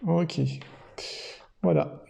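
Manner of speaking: usual speaking voice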